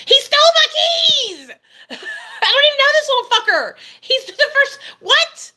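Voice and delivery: incredulous furious shout; outraged. Retold or imitated. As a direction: shriek the injustice, laughing at it. Outrage at police refusal.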